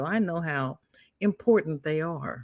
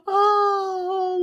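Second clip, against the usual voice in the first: high, helpless laughter; laughing hard; 13 semitones higher than usual. Real, not acted. Host laughs at Marsha Lee's sexy voice joke. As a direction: crack up at the joke.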